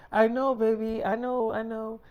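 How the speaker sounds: a hint of a sweet girlfriend calming her man; soothing girlfriend. Girlfriend soothing David about leaving, light cooing.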